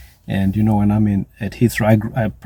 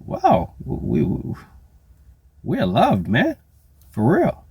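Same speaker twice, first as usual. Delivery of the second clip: amazed; 10 semitones higher, slower than usual. Retold or imitated. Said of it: "And I'm like".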